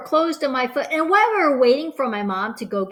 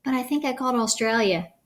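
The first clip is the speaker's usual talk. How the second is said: ordinary delivery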